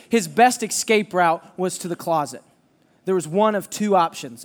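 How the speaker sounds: ordinary delivery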